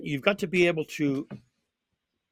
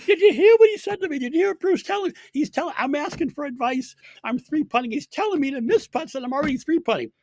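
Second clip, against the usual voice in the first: mocking the player in a much higher, laughing whine; hammed-up imitation of an upset, complaining golfer; high-pitched mock whine to a teammate, laughing; upset player mimicked; 13 semitones higher, 5 dB louder than usual. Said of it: "she was so upset with that advice".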